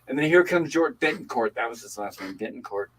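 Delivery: ordinary talk, nothing marked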